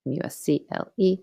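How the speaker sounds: each letter said separately, M U S C L E; spelled out. Shown as a demonstration. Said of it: "muscle contraction".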